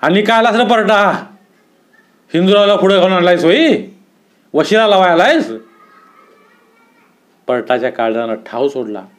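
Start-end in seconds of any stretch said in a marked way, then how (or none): 0.0-5.5 higher-pitched, scolding challenge; storyteller's indignant, accusing outburst; angry yelling. A narrator's reading. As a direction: snap at him like at a dog. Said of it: "कुत्र्यावर खेकसल्या का दादा ओरडले".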